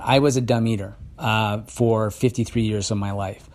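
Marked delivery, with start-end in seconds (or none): none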